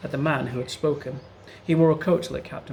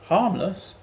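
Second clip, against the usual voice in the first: higher, louder scornful echo; growled scorn; 6 semitones higher, 4 dB louder than usual. A narrator's reading. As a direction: throw the word back with contempt. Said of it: "growled Cratchit".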